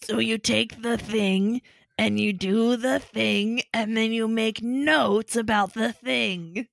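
drawn-out deadpan Roz-like voice; slow bored explaining voice; slow mock trainer voice.

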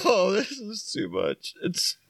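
high breathless laughing; wheezing through laughter; laughing hard. Real, not acted. Laughing fit at the picture.

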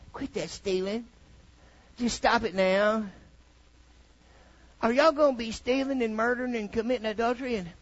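mock polite God. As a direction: play a meek, polite God who won't offend. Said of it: "he didn't say it like that".

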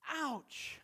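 sudden high yelp of pain; high, pained "Ouch". Retold or imitated. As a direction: yelp like you just hit your thumb. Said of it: "thumb with a hammer and go".

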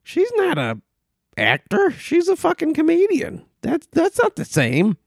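high, incredulous mock rant; mock outrage. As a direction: act baffled and indignant at the casting. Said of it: "except you're like".

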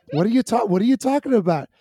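mock-indignant brother on the defensive; acted bafflement. Acting baffled brother on the phone.

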